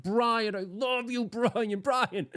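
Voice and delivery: high, gushing, squeezing the name out; gushing hug greeting. Pretend. Greeting friend Brian with a giant hug, high and gushing. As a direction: smother him with affection, high and sing-song. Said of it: "I'm gonna be like".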